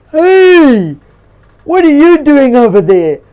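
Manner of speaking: a bear shouting to a stranger to come over; hollering. Acted. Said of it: "he hollered".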